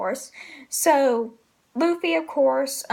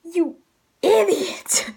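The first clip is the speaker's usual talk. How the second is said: higher hammed-up outburst at a fool; mock scolding; 3 semitones higher, slower than usual. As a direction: scold him in jest, jumping up on "idiot". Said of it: "it's just funny how it's like".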